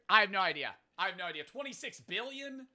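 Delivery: usual speaking voice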